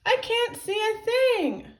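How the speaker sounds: high, loud complaint; high-pitched yelled protest; crying out. A narrator's reading. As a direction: holler it up high from inside a pocket. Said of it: "cried Tippy, Tiny Tippy".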